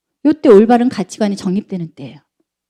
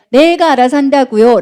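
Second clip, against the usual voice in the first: put-on teen talking back, higher and louder; 짜증 난 사춘기 아이; 4 semitones higher, 5 dB louder than usual. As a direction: push back like an irritated teenager. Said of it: "자, 아까 보세요". (퉁명스럽게 대드는 사춘기 말투)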